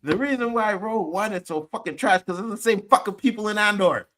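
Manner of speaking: fired-up fan tearing into a movie; angry rant. Real, not acted. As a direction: vent your disgust at the films. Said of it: "Tell me how you really feel".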